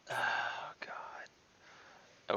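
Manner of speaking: breathy whispered oh god; exasperated whisper. Real, not acted. Player reacting to the group's bait plan, exasperated whisper. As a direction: whisper it under your breath, done with them.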